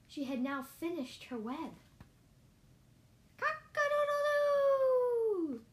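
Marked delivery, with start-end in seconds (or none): 3.4-5.7 loud, very high, long crow; full-out storybook cock-a-doodle-doo; rooster crow. Acted. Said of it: "cried the rooster".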